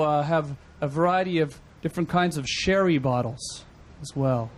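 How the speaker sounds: ordinary talk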